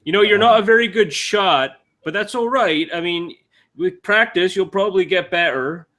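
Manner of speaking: high-pitched innocent character voice; well-meaning high-voiced encouragement; naive wanderer, consoling.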